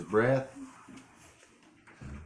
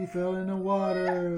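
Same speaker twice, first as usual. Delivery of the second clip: laughing; 10 semitones higher, 4 dB louder than usual. Real, not acted. Playful laughing remark.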